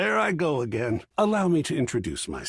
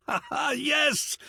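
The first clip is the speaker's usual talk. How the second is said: gleeful laugh; 13 semitones higher than usual. Acted. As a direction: burst into a bright high laugh of joy. Delighted laughing exclamation.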